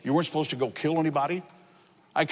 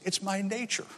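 soft, faint confession; hushed dying-scorpion voice; 2 semitones higher, 8 dB quieter than usual. Acted. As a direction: say it weakly with little volume left. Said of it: "scorpion is also about to die".

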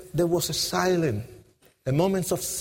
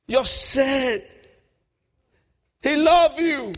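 higher, insistent raised cry; urgent shout; 5 semitones higher, 3 dB louder, slower than usual. Retold or imitated. Preacher urging a gang member, urgent shout. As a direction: press it home urgently, voice climbing. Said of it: "Wilkerson screamed at me".

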